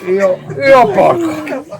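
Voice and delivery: cursing grumpy worker; muttered curses. Acted. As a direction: grumble curses while walking. Friulano cursing.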